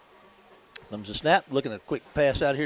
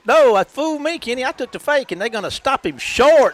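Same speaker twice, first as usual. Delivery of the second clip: raised, louder burst of surprise; excited live call; 7 semitones higher, 8 dB louder than usual. Real, not acted. Announcer admits to co-host he took the fake, excited. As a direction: call the sudden stop with real excitement.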